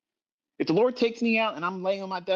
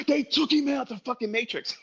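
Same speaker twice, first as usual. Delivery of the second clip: mock conspiracy theorist; 8 semitones higher than usual. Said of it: "all the conspiracies that would have started".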